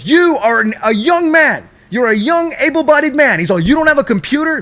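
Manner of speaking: high, scolding fatherly lecture; scolding like a dad. Retold or imitated. Agent lecturing caller like a dad, high scolding. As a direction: lecture him like a disappointed dad. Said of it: "Like, he's my dad".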